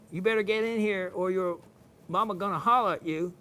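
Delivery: Southern "holler" with the ending dropped; Southern accent. Shown as a demonstration. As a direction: say it in lazy Southern talk. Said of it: "the Southern language is actually".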